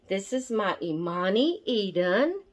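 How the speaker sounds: ordinary talk, nothing marked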